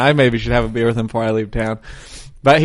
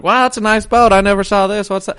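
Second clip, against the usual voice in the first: high, eager small talk; a light imitation of the uncle, pitched up; chatty uncle; 7 semitones higher, 3 dB louder than usual. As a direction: play the friendly uncle chatting up a stranger.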